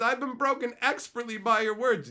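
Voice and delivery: loud, high proclamation; announcing. Real, not acted. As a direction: proclaim the quote to the crowd. Proudly announcing a viewer's quote.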